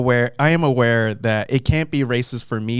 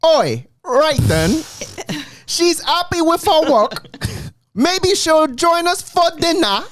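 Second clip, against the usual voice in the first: high, hammed-up cockney voice; a cook gossiping in a high cockney accent. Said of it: "the accent I'm going to do".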